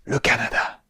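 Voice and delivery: quiet Le Canada; soft. Shown as a demonstration. Repeated drill, varied takes.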